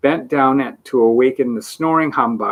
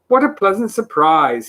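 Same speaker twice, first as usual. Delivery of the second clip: raised, pleased exclamation; man thrilled at a rare visitor; happy cry; 8 semitones higher, 4 dB louder than usual. A narrator's reading. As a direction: greet the guests with delight. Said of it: "he cried happily".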